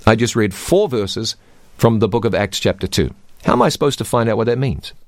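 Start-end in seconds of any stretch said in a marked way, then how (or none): none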